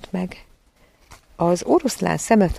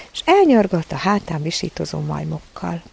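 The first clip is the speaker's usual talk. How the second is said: ordinary delivery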